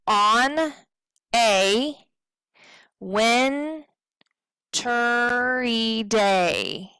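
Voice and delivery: each syllable apart, WINTRY drawn into win-ter-y. Shown as a demonstration. Teacher counting syllables, ON A WINTRY DAY syllable by syllable. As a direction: spell out "On a wintry day" beat by beat, long pauses. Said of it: "do the same thing with the second line".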